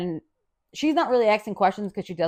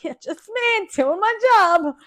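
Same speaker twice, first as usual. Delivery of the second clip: mock modest; 11 semitones higher, 3 dB louder, slower than usual. Modest reply to roommate.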